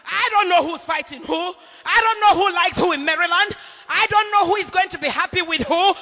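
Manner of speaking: loud, pleading outcry to the Lord; fervent cry. Real, not acted. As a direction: cry it out to God with full force. Crying out to the Lord.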